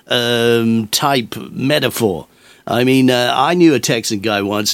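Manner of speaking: ordinary delivery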